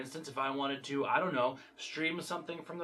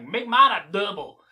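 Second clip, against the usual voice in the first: tough guy order; 9 dB louder than usual. Retold or imitated. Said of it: "is all like".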